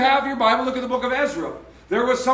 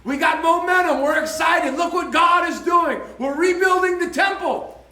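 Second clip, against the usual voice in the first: jubilant, raised-voice celebration; excited rejoicing; 6 semitones higher than usual. Retold or imitated. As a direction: shout it out like thrilled young builders. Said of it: "half of the people rejoiced".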